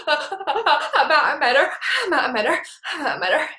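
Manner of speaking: gasping mock sobs between words; tearful, imitated. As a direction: sob it out in a hammed-up weepy voice. Still sobbing to husband, re-enacted.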